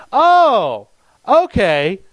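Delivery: loud, high "oh" of dawning understanding; acted realization. Pallbearer realizing the director means him, loudly acted surprise. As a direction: play up the penny dropping, high and loud. Acting sudden realisation he is Josh.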